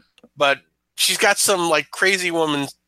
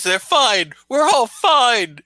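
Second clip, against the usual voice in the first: frantic mother; 8 semitones higher, 4 dB louder, slower than usual. Acted. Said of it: "And she says".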